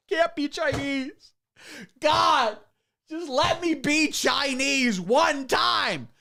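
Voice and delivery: mock Chinese accent. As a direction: beg heaven in a slow mock Chinese accent. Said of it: "Try doing the stream with a Chinese accent".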